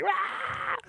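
high mock crowd squeal; crowd cheer. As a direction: squeal like an excited fan. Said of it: "everyone's just like".

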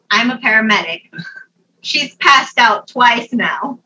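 loud, deliberate announcement, imitating a paramedic; announcing paramedic. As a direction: announce it loudly and slowly to a crowd. Paramedic announcing firmly, retold.

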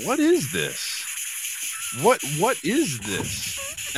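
slow, high, drawn-out puzzled question; puzzled desk clerk. Retold or imitated. Host imitating the confused front desk lady. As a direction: stretch it out like a baffled clerk. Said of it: "looks up and goes".

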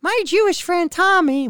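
high-pitched mock PSA voice; high, put-on commercial voice; old commercial imitation. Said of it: "remember that commercial".

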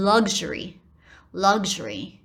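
luxury with a voiced "g" and "zh"; American luxury. Shown as a demonstration. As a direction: say luxury the American way, "lug-zhury". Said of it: "都会变成有声化".

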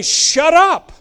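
high, loud snap of "Shut up"; sharp retort. Acted. Preacher acting out rebuking his own thoughts, loud snap. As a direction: shut the nagging voice down hard.

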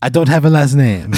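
hammy Italian waiter voice; bad Italian accent. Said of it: "a really bad Italian accent".

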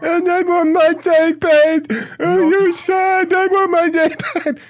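high, slow, whining mimicry of the caller; mock him like a sniveling child; squeaky mocking whine; mocking whiny voice. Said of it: "Listen to you".

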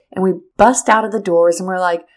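ordinary delivery

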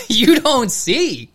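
high, loud, drawn-out disbelief; slow retold incredulous echo; mock incredulity. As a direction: stretch it out in exaggerated amazement. Retells his incredulous reply.

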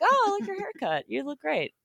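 high-pitched, sweet gushing; friendly compliment. Acted. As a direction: pay a compliment in a light high voice.

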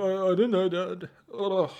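high, loud whine to Dad; mock whiny child. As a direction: whine like an embarrassed kid. Acts out embarrassed child answering dad.

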